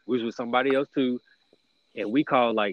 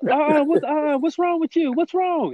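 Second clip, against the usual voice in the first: high, loud mock confused voice; imitating the puzzled person on the phone; confused caller; 4 dB louder than usual. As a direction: squeak out a worried question. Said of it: "somebody on the other line was like".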